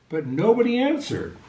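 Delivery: ordinary delivery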